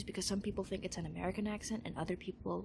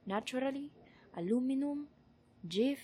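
Tagalog accent, each word lifted at the end; Tagalog-accented English without caricature; careful word list in a Tagalog accent; 4 semitones higher than usual. Shown as a demonstration. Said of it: "Tagalog accent that I can muster".

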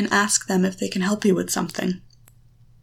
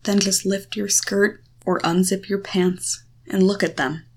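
usual speaking voice

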